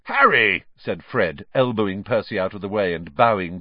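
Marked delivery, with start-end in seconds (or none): 0.0-0.6 hammed-up formal greeting, higher and louder; mock-pompous greeting. A narrator's reading. Fred bowing deeply to Harry, mock-pompous. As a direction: greet him with overblown ceremony.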